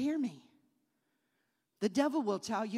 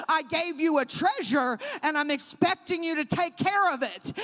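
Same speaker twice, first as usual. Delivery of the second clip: loud stern father warning; father's stern words shouted; 4 semitones higher, 7 dB louder than usual. Retold or imitated. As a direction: preach the father's charge louder. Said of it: "Daddy said".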